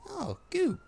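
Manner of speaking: high-pitched lovelorn exclamation; playful imitation of heartbreak; mock heartbroken. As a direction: coo it high, as a hammed-up heartbroken sigh. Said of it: "it sounds all heartbroken, and then it's like".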